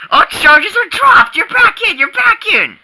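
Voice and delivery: high, hurried eager reconciliation; eager pleading. Acted. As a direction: win him back fast, all excitement. Eager to win friend back.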